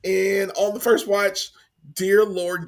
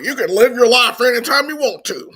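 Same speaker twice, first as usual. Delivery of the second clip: mock ad voice; 6 semitones higher, 6 dB louder than usual. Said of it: "And he's talking about".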